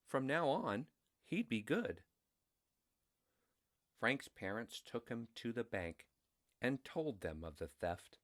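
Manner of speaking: usual speaking voice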